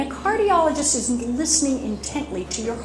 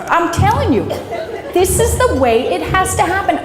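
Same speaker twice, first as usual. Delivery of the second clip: insistent quote; 4 semitones higher, 5 dB louder than usual. Retold or imitated. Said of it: "did somebody ever come up and say".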